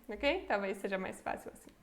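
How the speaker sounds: ordinary delivery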